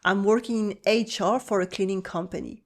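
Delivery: ordinary delivery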